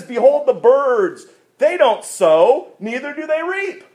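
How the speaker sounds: high, emphatic preaching voice; proclaiming scripture. Acted. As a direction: ring out each phrase to the congregation.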